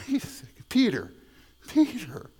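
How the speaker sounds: playful exasperated objection, jumping high; mock protest. Listener objecting to Peter's command to love deeply, mock exasperated. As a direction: plead with him in mock disbelief.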